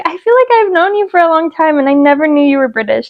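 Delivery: high, surprised mom voice; imitating friend's mom. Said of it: "she was like".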